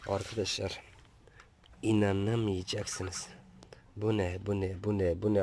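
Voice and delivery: breathless hushed whisper of amazement; astonished whisper. Real, not acted. Vlogger whispers to viewers, stunned by the swarming ants. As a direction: whisper it as if you can't believe your eyes.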